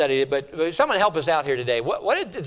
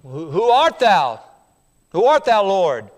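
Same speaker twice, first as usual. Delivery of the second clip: louder, urgent questioning; Saul asking; 5 dB louder than usual. Acted. Saul crying out to the voice, louder and urgent. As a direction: demand to know who speaks. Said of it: "and he said".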